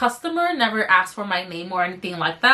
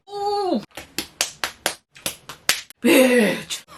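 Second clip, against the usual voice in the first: high "ooh", then a throaty "bitch"; throat-straining outburst; 4 semitones higher than usual. Acted. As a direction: push it from the throat, theatrically. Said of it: "I hurt my throat a little bit with that".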